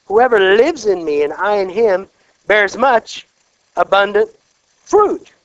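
loud, slow scripture proclamation; forceful preaching of the verse; preaching at full force. Acted.

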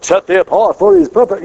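mild pompous sanctimony; mock religious language. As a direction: parody stiff pulpit phrasing. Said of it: "sounds a whole lot more reasonable than".

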